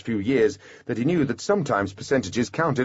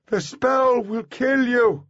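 high, drawn-out desperate shout; urgent high-pitched cry of danger; shouted warning; 4 dB louder, slower than usual. A narrator's reading. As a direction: shout slowly to warn them off. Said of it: "Artemis shouted".

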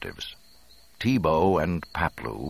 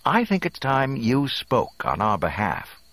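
raised, high calling voice; pointed, higher-pitched prompting of a servant; hint of impatience; 10 semitones higher, 4 dB louder than usual. A narrator's reading. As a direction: call out with contained impatience. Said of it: "called with the barest hint of impatience".

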